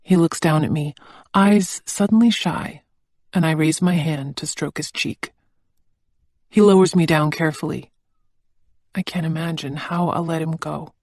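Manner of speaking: ordinary delivery, nothing marked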